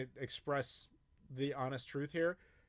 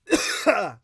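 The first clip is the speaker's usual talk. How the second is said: harsh, loud choking cough; loud coughing fit; 6 semitones higher, 14 dB louder than usual. Real, not acted. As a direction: cough hard as coffee goes down wrong. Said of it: "Down the wrong pipe".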